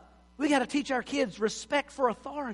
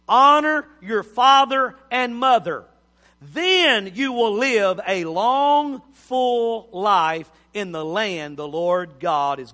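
slow, louder, weighty recitation; solemn scripture reading; 5 dB louder, slower than usual. Acted. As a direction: read the verse like holy law.

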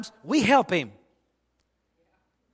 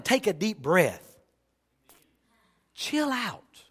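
soft, hushed prayer to the Lord; hushed sample prayer; quiet prayer; 5 semitones lower, 8 dB quieter than usual. Shown as a demonstration. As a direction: pray it gently, calming yourself down.

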